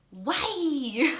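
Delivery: loud, high-pitched imitation of an exclaimed question; mock exclamation. Cuban host questions her veganism, mock exclamation. Retold baffled question, blurted out.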